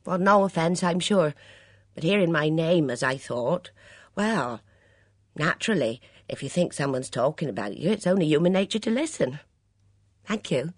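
mollified, chatty waitress; waitress cheering up. A narrator's reading. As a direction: explain yourself, no longer sulking. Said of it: "cheering up".

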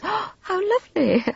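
high gasped gush; gasp, then coo the appropriate thing; strained, high-pitched delight; forced politeness. A narrator's reading. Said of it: "a little hoarse in timbre, saying the appropriate things".

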